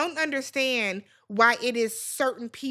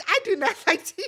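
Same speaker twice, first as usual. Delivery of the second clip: laughing; 6 semitones higher, much faster than usual. Real, not acted. Laughs mid-sentence.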